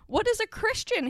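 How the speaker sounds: put-on high-pitched innocent child's question; little boy's wide-eyed question; innocent query. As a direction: ask it innocently, pitched right up. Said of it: "Harry queried innocently".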